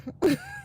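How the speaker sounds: high-pitched laugh at own story; amused laugh. Real, not acted.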